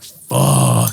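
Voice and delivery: very low, drawn-out curse; deep stoned reaction. Retold or imitated. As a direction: drop way down into a blissed-out groan. Acting out himself feeling the high.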